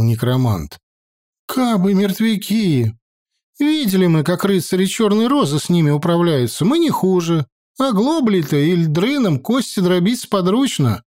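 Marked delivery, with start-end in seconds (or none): 1.5-11.0 higher, bold peasant woman voice; feisty village woman. A narrator's reading. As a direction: push back boldly, higher.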